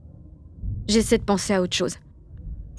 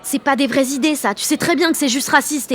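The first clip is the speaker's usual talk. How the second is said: higher, heated objection; 6 semitones higher than usual. Acted. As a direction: push back hard at her friend. Quarrel with Vanessa. (à Vanessa, indignée et montante)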